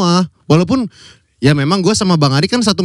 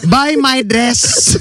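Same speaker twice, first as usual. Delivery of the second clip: put-on Thai accent; 7 semitones higher, much slower than usual. Mocking Thai seller.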